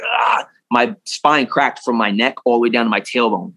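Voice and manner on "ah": high startled yelp; mock cry of surprise; friend's sudden yelp when shoved off; imitated yelp. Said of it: "He's like".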